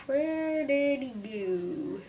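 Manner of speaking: very slow, stretched-out calling question; drawn-out question. Pretend. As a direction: stretch every word of the question way out.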